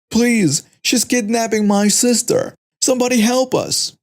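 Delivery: begging scream. Acted. Teen begging bystanders to stop the kidnapper, high pleading cries. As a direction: plead with the crowd, voice rising. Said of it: "begging someone to stop her".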